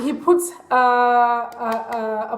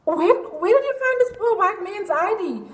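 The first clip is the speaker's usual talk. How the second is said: higher-pitched mock outrage; retold incredulous question; 9 semitones higher than usual. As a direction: demand it in exaggerated disbelief. Retells confronting him about the ID.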